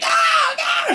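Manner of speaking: high mock scream of a scared girl; retold scream. Host retelling the girl refusing the basement, mock scream. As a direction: shriek like the girl being carried off. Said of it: "She's like".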